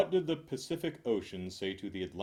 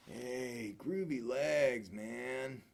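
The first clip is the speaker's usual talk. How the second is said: hippie voice; 3 semitones higher, much slower than usual. Acted. Hippie admiring someone's legs, slow mellow hippie voice.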